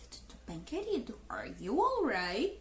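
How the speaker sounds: slow, hushed concern for a slow speaker; mock concern. Natives react to the slow learner, slow mock concern. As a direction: check on them softly and slowly. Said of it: "eles vão olhar assim".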